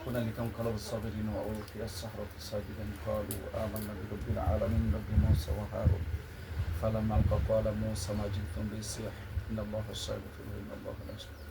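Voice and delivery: low, hushed chanted verses; quiet Quran recitation. Real, not acted. Healer recites verses over patient.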